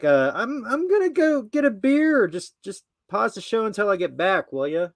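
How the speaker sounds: high, strained put-on voice; exaggerated high-pitched impression; mock character voice. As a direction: put on a loud high voice, as if calling out. Acting out Brad's exit line.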